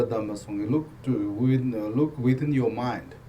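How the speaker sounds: usual speaking voice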